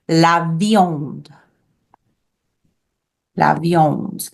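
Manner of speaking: la viande in clear teacher's French; French pronunciation. Shown as a demonstration. Teacher has class practice the word for meat, French pronunciation. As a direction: model "la viande" carefully for repeating. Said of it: "let's practice the word for meat".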